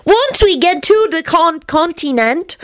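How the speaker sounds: put-on high child voice, word by word; slow, deliberate little-kid diction; careful enunciation. A narrator's reading. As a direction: sound out the long word carefully. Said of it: "he enunciated carefully".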